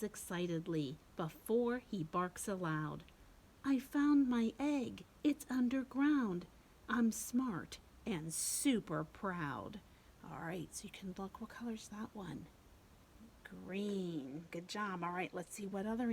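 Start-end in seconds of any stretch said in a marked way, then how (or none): 3.6-9.8 louder, higher, proud puppy. Acted. Puppy's rhyme read aloud. 10.2-12.5 whisper. Real, not acted. Whispered question to kids.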